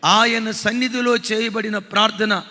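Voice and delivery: loud, slow pulpit proclamation; preaching at full force. Acted. Preacher proclaiming to the congregation, slow and loud. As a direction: thunder each promise out to the congregation.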